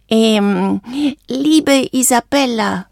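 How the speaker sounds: slow, hesitant, high voice; lump in throat. A narrator's reading. Valentino starting his goodbye to Isabella, hesitant. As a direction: begin haltingly, choked up a little. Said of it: "Er hatte einen Kloß im Hals".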